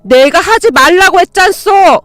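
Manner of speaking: high, slow, loud roar; house-shaking shout. A narrator's reading. Mother-in-law shoving the mother away, roaring. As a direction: bellow it out furiously. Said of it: "집안이 떠내려가라 소리를 지르시더니".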